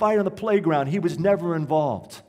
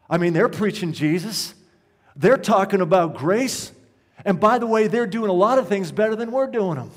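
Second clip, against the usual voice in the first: raised, insistent rhetorical questions; impassioned preaching; 4 semitones higher, 5 dB louder than usual. Real, not acted. Pastor challenges his church on its identity, impassioned preaching. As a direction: drive each question home to the congregation.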